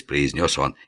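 ordinary delivery

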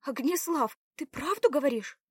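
high, loud hopeful question; joyful exclamation. A narrator's reading. Белава hears of escape, joyfully exclaiming. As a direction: lift the voice in eager disbelief. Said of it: "воскликнула радостно Белава".

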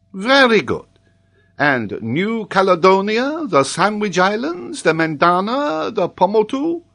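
loud opening, high questioning list; examining professor. A narrator's reading. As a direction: quiz a pupil pompously.

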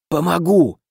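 ordinary delivery

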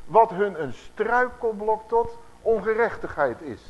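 slow, loud, weighty reading of scripture; solemn scripture reading. Acted. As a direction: declaim each word like a prophet. Preacher reads scripture aloud.

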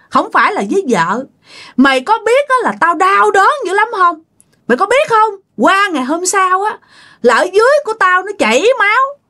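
acted high-pitched, wounded tirade; furious scolding. Mistreated wife berates her husband over her pain, angrily scolding. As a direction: lash out in hurt, pitch swinging high. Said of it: "chửi quá trời chửi luôn nha".

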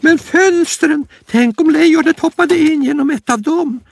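a tiny rabbit fretting about the windows; panicky little rabbit. Acted. Lille Skutt voiced.

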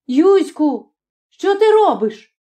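high-pitched startled cry; high, urgent outcry. A narrator's reading. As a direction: call out sharply, voice leaping up. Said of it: "скрикнула".

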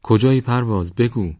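high, loud call into the fog; searching shout into the mist. Acted. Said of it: "این بار بلندتر گفت".

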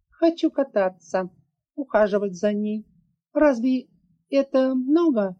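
put-on high child's voice, rising plea; pleading little girl. A narrator's reading. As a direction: daughter bargaining for a pony.

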